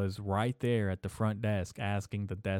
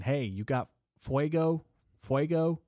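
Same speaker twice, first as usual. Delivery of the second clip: higher-pitched mock pestering question; imitating geeked-out guy; 6 semitones higher than usual. Geeked-out stranger asks couple for a lighter, lightly mimicked. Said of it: "severely geeked out of his mind".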